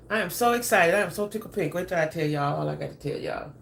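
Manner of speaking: ordinary talk, nothing marked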